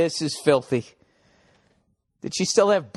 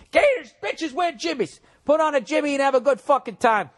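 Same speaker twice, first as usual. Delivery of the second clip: mock rally cry; 11 semitones higher than usual. Comic rallying cry to listener.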